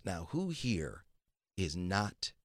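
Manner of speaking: slow, soft, loaded question with a threat underneath; slow menacing question. Retold or imitated. Boss asks who snubs him.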